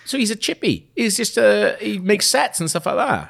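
put-on high sing-song Welsh accent. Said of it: "I can only say [name] with a really Welsh accent".

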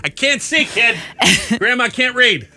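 put-on high, cranky old lady voice; mock grandma. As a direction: squint and grumble like a grandma. Voicing grandma's reply.

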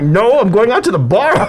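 high, loud indignation breaking into laughter; laughing protest. Retold or imitated. Son correcting mom's drug suspicion, laughing protest. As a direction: protest loudly while cracking up.

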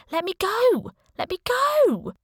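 high, rising acted shout; yelling for release. Cuffed captive yells for release.

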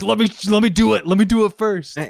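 high, insistent imitation; lightly hammed-up pushy begging; mock aggressive pleading. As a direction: push in eagerly, mimicking someone demanding a turn. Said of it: "It was really aggressive".

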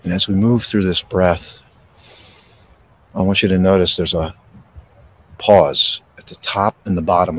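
gentle breathing-exercise guidance; guided meditation. Acted. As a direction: ease them into stillness, unhurried.

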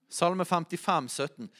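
ordinary delivery

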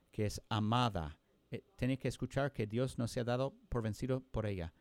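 normal talking voice

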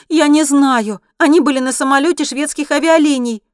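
high, loud, agitated woman; upset outburst, pitched high; distraught. A narrator's reading. Distraught woman answers the driver.

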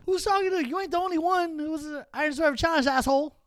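mock the crowd like a smug heel; cocky heel taunt. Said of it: "Yeah, yeah, he goes".

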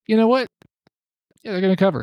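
high-pitched mock quote; airy, mocking caricature of a picker; mocking imitation.

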